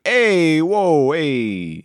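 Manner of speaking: put-on Italian accent, yelping in surprise; thick Italian accent. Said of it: "thick Italian accent".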